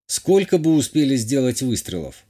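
plain reading voice

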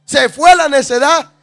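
fuerte y lento, martillando cada frase; predicación a toda voz. Acted. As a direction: predica el versículo con fuego. Predica el versículo con fuerza. (predicación encendida a la congregación)

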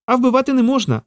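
high-pitched squirrel reproach; upset squirrel. Acted. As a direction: scold him in a high little creature voice. Squirrel scolds, Peter later repeats it.

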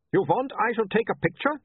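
a hint of an Uberwaldian photographer; lightly voiced Uberwaldian accent. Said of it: "don't make fun of his accent".

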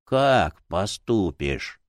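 low, very slow aged book spirit; old man's voice. A narrator's reading. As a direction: ask slowly in an old, low voice. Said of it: "старческим голосом".

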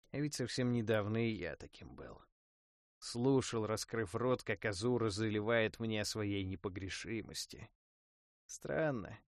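plain reading voice

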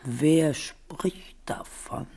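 slow whispered innocence; hushed denial. Acted. Queen denying she takes the son, slow whisper. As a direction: brush it off in a hush. Soothing denial to worried mother.

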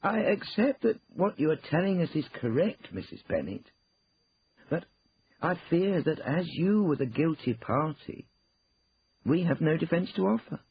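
high, loud, measured lawyer's pronouncement; formal lawyer, grave news. Acted. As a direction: spell out the bad news to a client. Lawyer gives bad news.

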